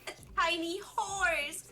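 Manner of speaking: put-on light Valley Girl accent, upturned; ditzy Valley Girl accent, lightly done. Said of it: "I will make it worse with my Valley Girl accent".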